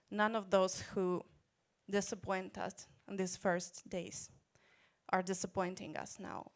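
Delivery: usual speaking voice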